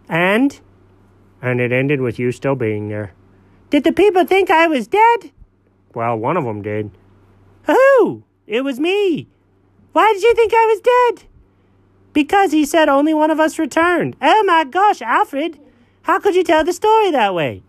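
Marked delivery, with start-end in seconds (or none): 3.7-5.3 high cartoon cat, scandalized; a high cat protesting he isn't dead; high-pitched cat scolding his friend; indignant cat voice. Cat character again. 7.6-17.6 high cartoon cat, scandalized; a high cat protesting he isn't dead; high-pitched cat scolding his friend; indignant cat voice. Cat character outraged.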